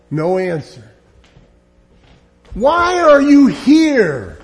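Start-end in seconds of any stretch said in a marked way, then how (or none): 2.5-4.4 slow, loud, higher demand; demanding question. Acted. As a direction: drag the words out in exasperation. Pilate interrogating silent Jesus.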